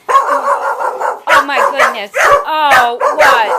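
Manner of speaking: high, loud startled cry; startled outcry. Real, not acted. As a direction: burst out in alarm at the dogs. Dogs suddenly start fighting.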